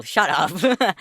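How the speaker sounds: ordinary talk, nothing marked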